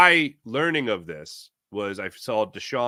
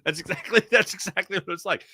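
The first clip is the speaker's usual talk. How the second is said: usual speaking voice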